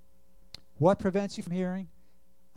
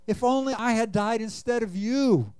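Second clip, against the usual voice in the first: higher, lamenting voice, a touch of weeping; grieving cry; 6 semitones higher than usual. A narrator's reading. Preacher reading King David mourning his son, grieving. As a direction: read it as a father's mourning cry. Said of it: "he cried".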